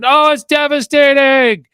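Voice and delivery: very high, very loud, drawn-out mock horror; hammed-up wailing race commentary; cycling commentator. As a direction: cry out in overblown commentator anguish. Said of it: "if you don't watch the commentary".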